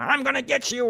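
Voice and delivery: high-pitched yowling threat; threatening cat. A narrator's reading. Cat chasing Mouse around the house, high yowled threat. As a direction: screech it mid-chase.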